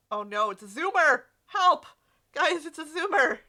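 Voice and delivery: frantic cry for help; panicked yelling. Acted. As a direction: shout it out in a panic. Panicked character calling for help.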